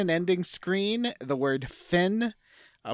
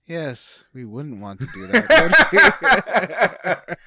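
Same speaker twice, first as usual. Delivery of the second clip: Moira impression; 9 dB louder, much slower than usual. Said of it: "she's like".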